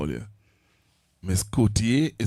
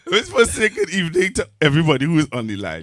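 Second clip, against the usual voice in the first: normal talking voice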